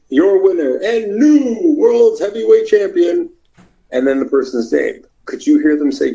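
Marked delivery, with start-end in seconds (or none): 0.1-3.3 big, high, stretched-out title announcement; ring announcer. Shown as a demonstration. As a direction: announce a new champion to the arena, slow and booming. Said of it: "You gotta be able to say".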